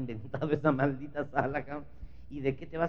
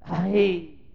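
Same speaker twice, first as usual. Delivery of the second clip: usual speaking voice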